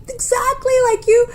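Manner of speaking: high, weepy retold blubbering; mock crying. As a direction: imitate someone crying through the words. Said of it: "dying, crying".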